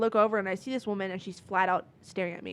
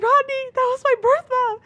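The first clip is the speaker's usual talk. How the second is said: high, loud re-enacted freak-out; frantic outburst; 9 dB louder than usual. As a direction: blurt it out in mock panic, pitch way up.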